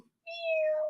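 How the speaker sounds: tiny high, soft mew; cat meow. Acted. Storyteller making cat sounds, high soft meow. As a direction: mew faintly like a kitten. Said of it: "It says meow".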